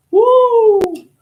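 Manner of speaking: shrill, loud celebratory whoop; high whoop. Real, not acted. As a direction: let out a wild cheer.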